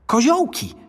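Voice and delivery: put-on high-pitched boy correcting; boy's quick corrective interjection; reflexive correction. A narrator's reading. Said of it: "poprawił odruchowo chłopiec".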